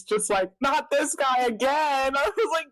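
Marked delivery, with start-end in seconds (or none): 0.6-2.1 high, drawn-out exasperated groan of words; going bananas. Retold or imitated. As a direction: stretch it out in mock dismay. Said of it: "I was going bananas".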